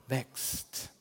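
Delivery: whispered. Shown as a demonstration. Preacher repeating his refrain to the congregation, whispered. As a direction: breathe "wächst" as a soft, voiceless whisper.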